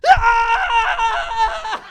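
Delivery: high, loud imitated sleep scream; mock scream. Boy screaming in his sleep, imitated, high and loud. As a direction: let out a mock scream at the top of the lungs. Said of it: "at the top of his lungs, screaming".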